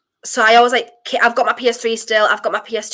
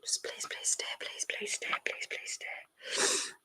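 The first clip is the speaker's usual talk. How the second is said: very soft, breathy begging whisper; pleading whisper; 20 dB quieter than usual. Real, not acted. Fan begs the ball to stay in play, whispered pleading. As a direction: beg under your breath.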